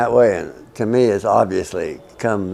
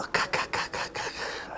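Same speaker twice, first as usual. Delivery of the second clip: whispered tapping sounds; 14 dB quieter than usual. Retold or imitated. Tapping the letter K.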